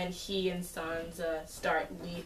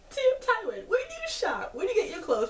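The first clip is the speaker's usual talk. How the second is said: squealing, much higher and louder; gushing fangirl; 5 dB louder than usual. Real, not acted. Vlogger gushing at Tywin about his clothes, squealing high. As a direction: gush over his outfit with a laugh. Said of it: "fierce to death".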